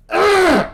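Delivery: loud, high frustrated yell. Real, not acted. Gamer yelling in frustration, loud and high. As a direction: let out a loud ugh of rage.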